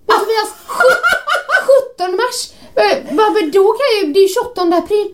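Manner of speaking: high, laughing, incredulous protest; laughing disbelief. Retold or imitated. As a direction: laugh in disbelief at the wrong date. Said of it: "Och jag bara".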